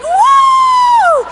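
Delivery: one very high, long "woo"; delighted high shriek; excited whoop. Retold or imitated. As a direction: let out a thrilled holler. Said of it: "He gets so excited every single time".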